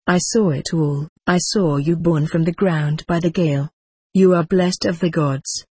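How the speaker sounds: plain reading voice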